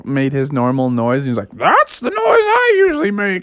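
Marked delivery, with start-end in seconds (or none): 1.5-3.4 high, strained creature shout; high roaring alien voice; Chewbacca. Acted. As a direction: holler it like a howling movie beast. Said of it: "The mighty Chewbacca popped out".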